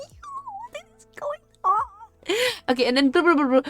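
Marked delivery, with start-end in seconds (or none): 0.0-2.3 very high, squeaky disbelief; appalled, voice shooting way up; aghast disbelief. Real, not acted. Aghast reaction to the script.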